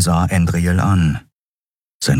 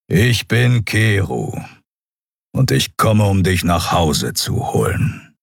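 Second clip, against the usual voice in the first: slow, lightly voiced big cat-man; solemn beast-man; slower than usual. A narrator's reading. Cat-man tells prisoner he will bring her home, slow and solemn. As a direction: announce it slowly, sinking towards the end.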